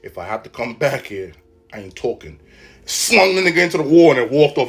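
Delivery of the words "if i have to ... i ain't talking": dropped low and soft, close in; dad threatening teacher up close; low quiet threat. Retold or imitated. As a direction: menace him quietly up close. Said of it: "he's like".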